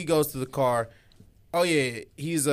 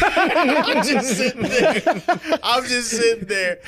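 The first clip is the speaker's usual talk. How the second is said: high-pitched, laughing through the words; 9 semitones higher, 4 dB louder, slower than usual. Real, not acted. Telling friends about being stuck, laughing. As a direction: crack up while telling it.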